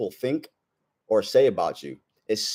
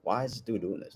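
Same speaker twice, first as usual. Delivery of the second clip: inner thought; 11 dB quieter than usual. Retold or imitated. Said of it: "thinking to myself".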